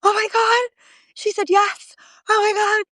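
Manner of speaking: high, breathless overjoyed squeal; imitating emotional friend. Retelling friend's call after the vote, high overjoyed squeal. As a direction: mimic her tearful joy on the phone. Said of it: "and Marika's crying".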